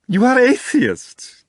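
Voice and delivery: high, loud and slow delighted squeal; squealing with curiosity. A narrator's reading. The stranger thrilled by the writers' atheism, squealing high. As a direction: squeal it with childlike glee. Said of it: "practically squealing with curiosity".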